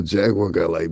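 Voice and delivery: usual speaking voice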